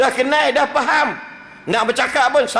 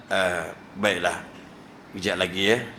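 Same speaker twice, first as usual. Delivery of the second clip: gentle polite reply; 9 semitones lower, 7 dB quieter than usual. Acted. Prophet gently declining food his wife offers. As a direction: decline softly, kindly. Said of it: "Ya Rasulullah, jemputlah".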